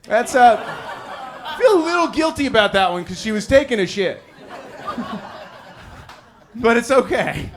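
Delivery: real guilty laughter; laughing guiltily. Real, not acted. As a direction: laugh while confessing.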